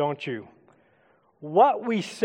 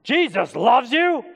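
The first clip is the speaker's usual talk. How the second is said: higher, slow, punchy exclamation; emphatic proclamation; 5 semitones higher, 4 dB louder, slower than usual. Shown as a demonstration. Preacher varying the message for church, big emphatic exclamation. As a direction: proclaim it big, stretching every word. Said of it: "what if we change the message".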